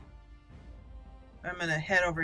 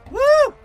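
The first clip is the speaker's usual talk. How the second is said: loud, high-pitched "woo"; excited whoop; 13 dB louder than usual. Real, not acted. Player cheering a hit in a game fight, excited whoop. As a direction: let out a big victory whoop.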